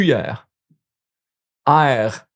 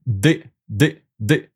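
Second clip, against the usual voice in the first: ordinary delivery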